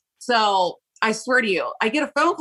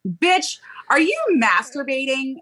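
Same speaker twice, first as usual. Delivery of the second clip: best friend's mock shock; 6 semitones higher, slower than usual. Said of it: "she was like".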